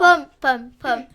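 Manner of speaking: loud chant of pomme; shouting. Real, not acted. Shouting team choice for chat.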